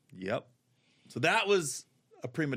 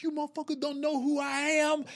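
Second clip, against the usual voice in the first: mock diva threat; 12 semitones higher than usual. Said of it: "He was like".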